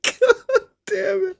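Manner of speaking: high, drawn-out helpless laughter; real laughing. Real, not acted. Cracking up at the friends' bit, real helpless laughing. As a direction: squeeze the words out softly through laughter.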